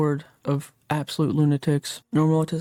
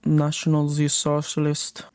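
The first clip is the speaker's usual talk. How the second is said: slow, put-on German accent; slower than usual. Streamer swapping in the term for the four-letter word, playful German accent. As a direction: say it slowly with a mock German accent. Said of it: "trying to do a German accent".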